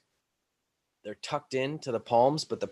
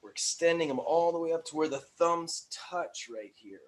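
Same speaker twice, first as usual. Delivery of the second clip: ordinary talk, nothing marked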